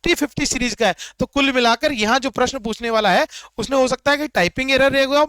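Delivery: ordinary delivery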